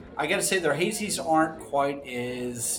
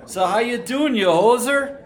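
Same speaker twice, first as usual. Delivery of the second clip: loud, higher-pitched hammed-up Canadian; mock Canadian accent; 9 semitones higher, 9 dB louder than usual. One host greets the other as a hoser, mock Canadian accent. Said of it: "You're Canadian, eh?".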